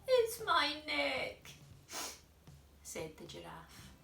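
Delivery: high, sniffly, sad giraffe voice. Acted. Cold giraffe tells George about its long neck, sniffling sadly. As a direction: whimper it like a cold little giraffe. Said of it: "who was sniffing sadly".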